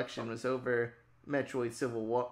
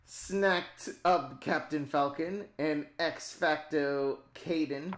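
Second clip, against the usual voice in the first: ordinary talk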